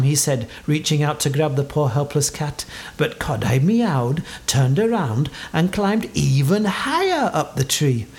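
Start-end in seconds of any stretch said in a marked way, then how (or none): none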